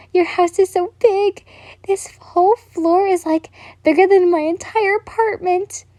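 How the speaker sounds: put-on high, light girlish voice; girl's voice. A narrator's reading. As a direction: voice the awestruck girl up high. Reader voices the girl's lines.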